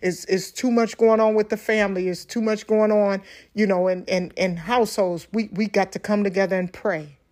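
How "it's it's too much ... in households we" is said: ordinary talk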